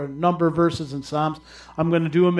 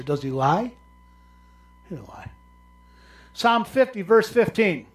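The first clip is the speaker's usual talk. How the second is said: ordinary delivery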